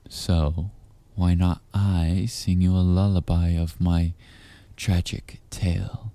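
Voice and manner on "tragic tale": ordinary talk, nothing marked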